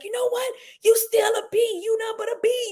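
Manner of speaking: high, sneering taunt; high mocking insult. Boyfriend turning on her after apologizing, high mocking taunt. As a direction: jeer it up high like a nasty boy. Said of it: "all of a sudden he's like".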